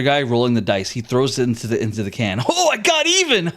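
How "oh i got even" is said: high-pitched gloating cheer; gleeful soldier. Acted. Soldier rolls evens in a cruel game, gleeful. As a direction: crow with glee, pitch jumping up.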